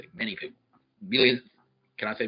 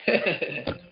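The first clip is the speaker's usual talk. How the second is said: slow, loud laughing taunt; laughing threat; 6 semitones higher, 7 dB louder than usual. Real, not acted. Taunting Joe about where he's going, slow laughing threat. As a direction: gloat it, amused and menacing.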